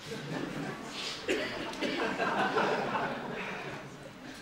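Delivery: soft breathy laugh drifting off; drowsy chuckle. Acted. Producer settling in for a nap chuckles softly and breathily to himself. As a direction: chuckle yourself off to sleep.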